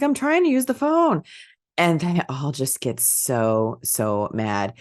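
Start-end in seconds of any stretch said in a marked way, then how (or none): 0.0-1.2 very high imitation man-child voice; amateur impersonation, squeaky complaint at normal volume; Pee-wee. Caller complaining to noisy bikers, high mock whine. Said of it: "I don't have a good Pee-wee impersonation".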